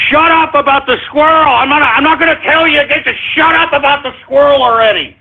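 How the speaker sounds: high, insistent angry outburst; exasperated demand. Real, not acted. Host warning listeners about squirrel jokes, exasperated demand. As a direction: keep the pitch up as patience runs out.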